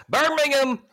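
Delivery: high, loud cheer; excited cheer. Real, not acted. Host cheering his co-host's pick, loud and high. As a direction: shout the team name in triumph.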